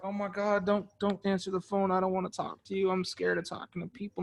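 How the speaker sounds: soft, shrinking and nervous; mock a caller afraid of the phone; timid scared voice. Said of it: "tucked in and tight and closed".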